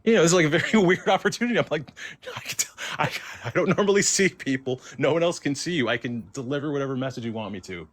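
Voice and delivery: high-pitched, giggly rush of words; laughing while talking. Real, not acted. Teller recalls offering to carry messages, laughing as she talks. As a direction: talk through real laughter at how odd it is.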